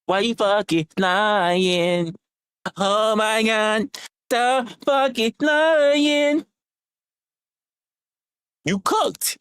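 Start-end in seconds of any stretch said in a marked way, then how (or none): 0.0-6.5 drawn out slow mocking taunt; slow teasing chant; slow sing-song taunt. Shown as a demonstration. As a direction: stretch every word like a schoolyard taunt. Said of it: "here's what I'm gonna say".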